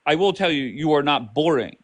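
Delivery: normal talking voice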